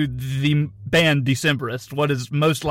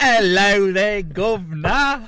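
mock Cockney; slower than usual. Mock British banter.

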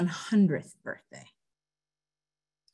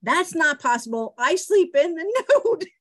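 put-on higher, louder prim old lady; mother's zinger; 10 semitones higher, 5 dB louder than usual. Hundred-year-old mother answers the teasing cousin, high and prim. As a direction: deliver the punchline in a high, prim voice. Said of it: "To which she replied".

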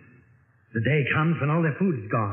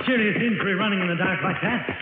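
usual speaking voice

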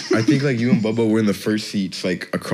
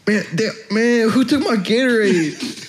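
much higher, mock-whiny coach complaint; 12 semitones higher than usual. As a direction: squeak it like an annoyed grown man. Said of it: "he was like".

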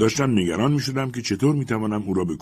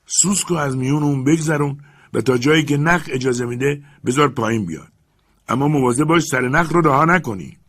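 higher, louder firm orders; commanding master; 6 semitones higher, 5 dB louder than usual. Acted. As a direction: lay down the instructions firmly. Legrand instructs.

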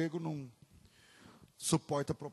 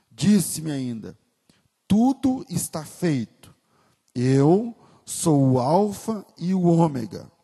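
louder, slower proclamation of the verse; solemn scripture reading; 10 dB louder than usual. Acted. Pastor reading Revelation to the church, solemn and loud. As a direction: read Revelation as a grand proclamation.

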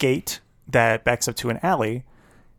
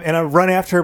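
ordinary delivery

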